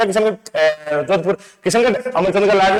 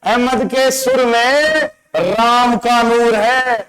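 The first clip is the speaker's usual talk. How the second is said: drawn-out, slow, lingering on each word; slow poetic recital; slow mnemonic recital; 3 semitones higher, much slower than usual. Shown as a demonstration. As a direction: recite it slowly, like a line of verse. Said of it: "क्या बात बोली है".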